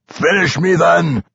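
high, strained roar; defiant dwarf yell. A narrator's reading. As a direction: roar a desperate challenge. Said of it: "he roared".

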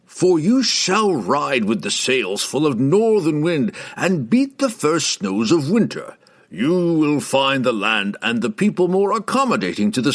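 put-on a grave, deliberate old mage counselling heroes; old wizard voice. A narrator's reading. Malcor encourages them.

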